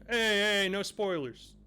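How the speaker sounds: loud, high half-joking protest. Real, not acted. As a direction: call out chat for spoilers. Chat posted spoilers.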